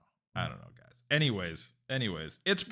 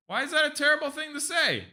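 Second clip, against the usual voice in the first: much higher, outraged question; indignant protest; 12 semitones higher, 3 dB louder than usual. Real, not acted. Defending himself to Jules, indignant. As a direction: protest that it's unfair.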